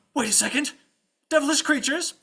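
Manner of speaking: high, loud dawning realization; alarmed squire. Acted. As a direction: exclaim as the truth hits you.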